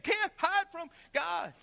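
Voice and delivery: a slow, high imitation of the preacher; slow preacher variation. Another of the preacher's variations, slow and high. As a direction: drag it out like the preacher. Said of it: "He would do all these variations".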